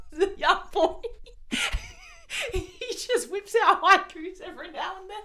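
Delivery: high-pitched, giggly telling; laughing through words. Real, not acted. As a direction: tell it while cracking up. Retelling story through laughter.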